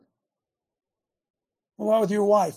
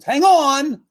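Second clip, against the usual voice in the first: loud shout; 8 semitones higher, 11 dB louder than usual. Real, not acted. Interrupting himself, loud.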